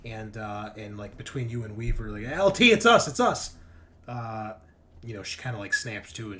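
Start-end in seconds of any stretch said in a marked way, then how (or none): 2.3-3.5 loud, high urgent call; raised, pressing shout of reassurance. Acted. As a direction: call out to get through to a panicked crewmate. Calling to a panicked crewmate.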